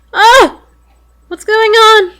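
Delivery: sudden high yelp of alarm; startled shout. Real, not acted. Player jolted by a sudden image, loud startled cry. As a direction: jump at the scare, loud and high. Sudden shock in horror game.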